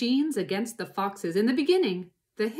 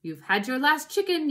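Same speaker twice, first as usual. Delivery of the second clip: louder, higher threatening yell; 6 semitones higher, 6 dB louder than usual. A narrator's reading. Boggis threatening the fox, raised yell. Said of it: "yelled Boggist".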